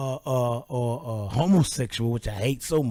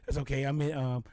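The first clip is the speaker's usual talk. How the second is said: ordinary delivery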